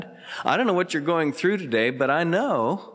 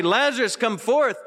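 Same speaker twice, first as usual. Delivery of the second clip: raised, higher commanding call to the dead; 9 semitones higher than usual. Retold or imitated. Jesus calling Lazarus from the tomb, commanding call. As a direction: call him out of the tomb with authority. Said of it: "He was gonna say".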